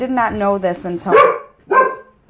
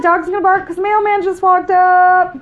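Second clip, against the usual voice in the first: excited; 9 semitones higher, 5 dB louder than usual. Real, not acted. Aside after fanfare, still raised.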